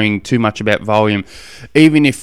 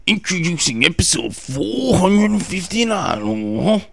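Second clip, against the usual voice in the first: hammed-up wrestler voice with drawn-out words and big pitch swoops; swooping mock wrestler promo; Macho Man Randy Savage; 6 semitones higher, slower than usual. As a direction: put on a bad wrestler impression, stretching and sliding. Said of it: "Macho Man Randy Savage".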